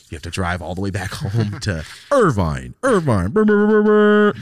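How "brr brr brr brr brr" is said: high loud brr engine sound; engine revving noise. Pretend. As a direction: rev like a car engine.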